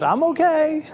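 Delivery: high-pitched mock little-girl call; imitating a child's shout. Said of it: "you hear her shout".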